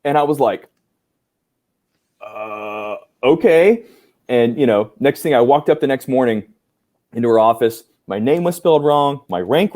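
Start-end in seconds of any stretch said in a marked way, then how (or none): 2.2-3.8 stunned hesitation. Retold or imitated. Trainee answering the commander's surprise offer, stunned and hesitant.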